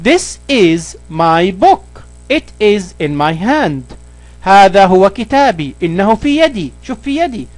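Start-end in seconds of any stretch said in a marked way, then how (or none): 0.0-4.0 slow, careful reading of the English example. Shown as a demonstration. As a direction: read each word slowly for the learner. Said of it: "This example". (قراءة بطيئة وواضحة للمثال)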